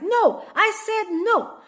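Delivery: put-on high, loud child's protest; child grabbed at the fridge, high and loud; defiant child. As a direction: yell it like a toddler clutching chocolate. Kid grabbed holding chocolate.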